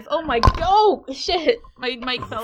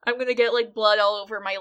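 normal talking voice

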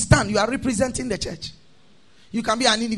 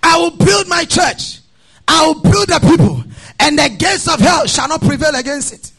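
ringing scripture proclamation; full-force preaching; 4 semitones higher, 6 dB louder than usual. Real, not acted. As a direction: proclaim it forcefully to the whole congregation.

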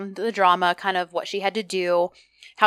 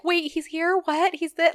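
high-pitched mock panic; startled disbelief; 9 semitones higher than usual. Edda hearing from friends that he's here, mock startled. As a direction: imitate someone caught off guard, voice jumping up. Retelling Edda's startled reaction.

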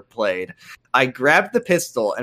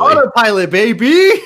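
loud gleeful cheer, pitched up; triumphant shout; 8 semitones higher, 7 dB louder than usual. Real, not acted. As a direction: shout it like you just won.

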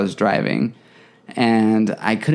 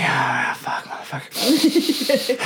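high, drawn-out strained cursing; acted-out struggle to breathe through it; panicked breathing through nausea; 4 semitones higher, much slower than usual. Driver fighting nausea on the way home, panicked. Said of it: "breathing, trying to breathe through it".

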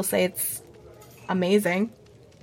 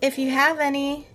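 put-on kid voice; 6 semitones higher than usual. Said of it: "And he goes".

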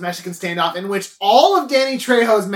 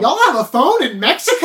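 high, laughing mock disbelief; exaggerated amazement, pitched up; mock astonishment; 6 semitones higher than usual. Mock amazement at phone in Mexico.

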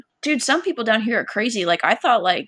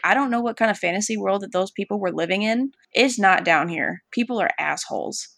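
ordinary talk

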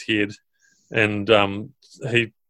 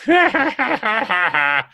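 shrill giggle of the little ogre, much higher; high-pitched laugh; 12 semitones higher than usual. Acted. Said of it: "laughs with this high-pitched laugh".

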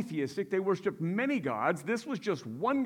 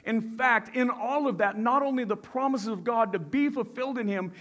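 normal talking voice

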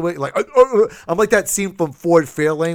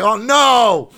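louder, higher oh no; a hammed-up groan of dismay; mock dismay; 8 semitones higher, 5 dB louder than usual. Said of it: "I was like".

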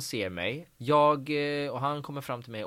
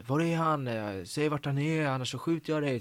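higher-pitched, lightly acted menace; threatening gunman; 5 semitones higher than usual. Actor retelling his co-star's gun threat, mock-menacing. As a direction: retell an armed threat, stretching the demand. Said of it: "så här, hotad och bara".

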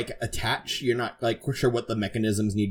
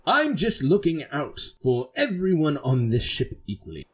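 lightly voiced noble knight, higher pitch; self-righteous knight; 6 semitones higher than usual. Knight answering an accusing crewmate, lightly self-righteous. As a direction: defend yourself with mild pomp. Braddock defends himself in character.

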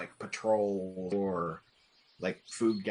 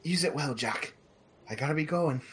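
higher-pitched, lightly acted apparition; dream visitor's farewell; 7 semitones higher, faster than usual. Dead friend taking leave of the dreamer, lightly voiced in higher pitch. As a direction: send them off in a lifted character voice.

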